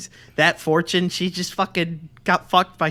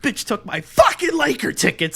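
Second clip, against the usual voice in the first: high, cracking mock rant; mock-furious squeal on a phone rant; Mel Gibson, voice cracking; 7 semitones higher than usual. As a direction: imitate an unhinged caller whose voice breaks upward. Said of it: "his voice cracks and shit".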